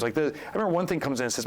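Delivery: usual speaking voice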